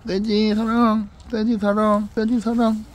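high, drawn-out singsong to a pet; affectionate cooing. Real, not acted. Owner telling the dog it is loved, high singsong cooing.

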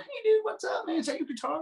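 hammed-up high buddy voice; imitating Vince. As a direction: pitch it way up like an excited surfer dude. Vince greeting him, acted quote.

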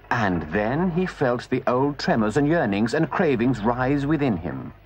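plain reading voice

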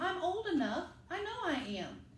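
put-on a small boy insisting he's old enough; little Sam insisting.

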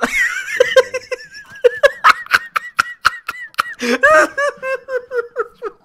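loud high howling laughter. Real, not acted. Trying the impression and breaking down, loud howling laugh.

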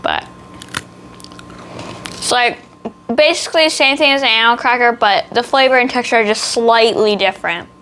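speaking between bites; talking while chewing. Real, not acted. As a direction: mouth partly full of biscuit. Seen 3: Bites biscuit at mouth 0-2.7s, then talks holding it, likely chewing.

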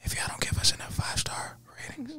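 hushed, voiceless mock-threat whisper. Shown as a demonstration. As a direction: drop right down into a whisper. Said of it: "I'll just talk like this".

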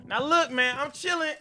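ordinary talk

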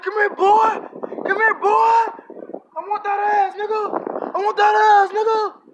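loud, very high mock holler; menacing homeless pursuer. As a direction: imitate a crazed man shouting as he chases you. Chasing homeless man, acted out.

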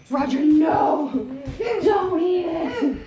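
loud, high, slow alarmed shout; startled shout. Real, not acted. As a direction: stop the dog in alarm. Mother shouting at the dog.

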